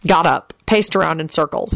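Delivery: ordinary delivery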